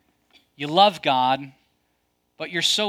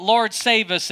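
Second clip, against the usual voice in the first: high, mildly raised crowd cry; imitated crowd shout; 6 semitones higher, 4 dB louder, slower than usual. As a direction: call it out like a festival crowd. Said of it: "they're shouting out".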